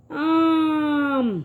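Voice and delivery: "آم" with the آ held very long; long drawn آ sound like a teacher. Shown as a demonstration. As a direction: stretch the آ in "آم" slowly. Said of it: "اس کی آواز تھوڑی کھچ کے آتی ہے".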